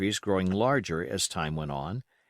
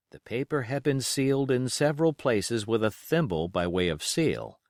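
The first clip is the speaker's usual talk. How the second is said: usual speaking voice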